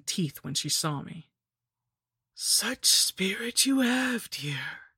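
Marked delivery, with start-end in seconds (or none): 2.4-4.9 high, slow whispered rasp; slow rasping hag whisper; raspy lich. A narrator's reading. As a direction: rasp it like an old crone. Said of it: "she rasped".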